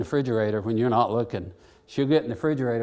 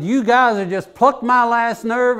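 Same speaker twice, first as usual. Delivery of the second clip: higher, louder exasperated scolding; retold angry yell; 9 semitones higher, 7 dB louder than usual. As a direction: scold them at the end of your rope. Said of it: "And I said".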